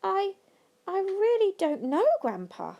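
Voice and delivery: put-on hesitant, halting boy's voice; stammered. A narrator's reading. Charlie unsure, answering Grandpa, stammering. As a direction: stumble over the first word. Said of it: "Charlie stammered".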